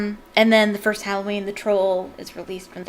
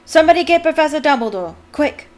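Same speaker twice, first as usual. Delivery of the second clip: loud, higher, fast alarmed command; sharp urgent call; 7 semitones higher, 10 dB louder than usual. Acted. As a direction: snap it out in sudden alarm. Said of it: "in a suddenly sharp voice".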